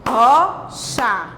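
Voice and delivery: "Rocha" in two separated beats, RO, pause, CHA; syllable by syllable. Shown as a demonstration. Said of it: "bati palma duas vezes". ("Rocha" separado em duas sílabas)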